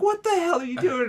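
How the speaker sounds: mock shouting at a panicking friend; incredulous shout. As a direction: yell at him in disbelief. Retold exasperated shout at friend.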